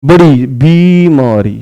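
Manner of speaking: बड़ी बीमारी slowly, leaning on the long ई sounds; slow it down and stretch the ई in बीमारी; stressed long vowels. Shown as a demonstration. Teacher shows students the long ई, slow and stressed. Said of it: "बड़ी ई की मात्रा लगती है".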